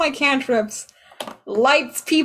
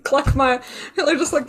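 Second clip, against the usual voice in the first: normal talking voice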